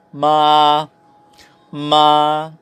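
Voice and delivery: MA held high and level, long; "ma" drawn out level, no rise or fall; first tone. Shown as a demonstration. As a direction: sing "ma" on one steady high pitch. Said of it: "yung pitch mo starts high and stays high".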